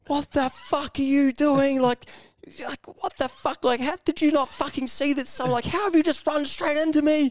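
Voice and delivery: high-pitched mock-outraged pedestrian; losing it. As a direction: imitate someone losing it, voice shooting way up. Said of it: "they just lost their shit at me".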